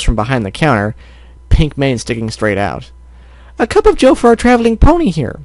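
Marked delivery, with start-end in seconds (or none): 3.6-5.4 high, bright fussing baker voice; high motherly baker. Acted. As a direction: coo like a doting baker mom. Mrs Cake voice.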